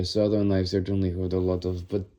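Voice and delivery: normal talking voice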